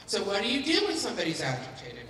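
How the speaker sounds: normal talking voice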